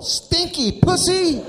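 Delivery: put-on very high, slow kid's cry; kid yelling. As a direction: stretch it out like a gleeful child on the phone. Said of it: "here comes the phone call".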